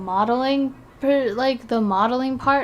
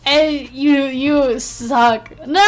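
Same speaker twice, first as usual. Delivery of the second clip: laughing playful insult; 3 semitones higher, 8 dB louder, much slower than usual. Real, not acted. Still reacting to the clip.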